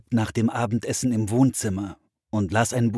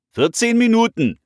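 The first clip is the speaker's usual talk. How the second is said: loud, raised parental shout; calling back; 8 dB louder than usual. A narrator's reading. Father answers his children from afar, calling back loudly. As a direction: call a firm limit across the house. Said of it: "rief ihr Vater zurück".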